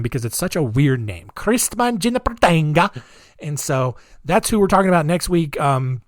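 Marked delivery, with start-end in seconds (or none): 1.3-3.0 "Christman Jinipertenga" in a hammed-up mock German accent, pitched up; fun little accent. Shown as a demonstration. Host repeating the weird name for fun, playful mock German accent. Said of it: "I have to say that name with a fun little accent every time".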